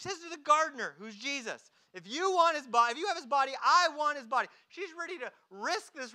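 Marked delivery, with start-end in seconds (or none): 1.9-4.5 loud, high, urgent acted demand; forceful demand. Mary demands Jesus' body from the gardener, forceful. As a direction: insist on it, raising pitch and volume.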